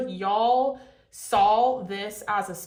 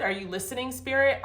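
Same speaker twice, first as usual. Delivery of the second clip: ordinary talk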